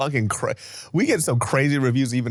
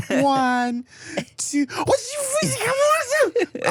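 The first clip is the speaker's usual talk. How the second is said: one slow count, then a high frantic gabble; countdown breaking into gabble; much slower than usual. Retold or imitated. Kid told to count slower, impatient gabble. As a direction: start counting properly, then give up and rush it. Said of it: "count slower".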